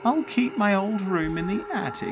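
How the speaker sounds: high eerie voice of a bossy dead grandmother; ghost granny voice. Acted. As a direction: play the ghost granny, unbothered.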